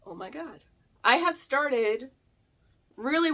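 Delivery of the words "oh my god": much softer, stunned; soft amazed murmur; awed hush. Retold or imitated. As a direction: breathe it out in awe. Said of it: "I was like".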